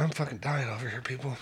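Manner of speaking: pained by heartburn. Real, not acted. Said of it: "Oh, I got heartburn".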